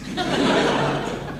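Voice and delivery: soft laugh. Real, not acted. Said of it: "Then I call it".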